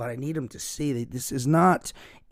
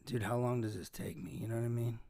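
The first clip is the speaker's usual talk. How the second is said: breathy, much softer muttering; sighing under breath; 3 semitones lower, 12 dB quieter, faster than usual. Real, not acted. Host annoyed at himself, sighing softly. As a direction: sigh it out, barely voiced. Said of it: "sighing, exasperated".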